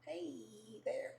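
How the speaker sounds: tiny coy hello to a crush; nervous shy greeting. Retold or imitated. Younger self greeting her crush, shyly. You gotta not be nervous.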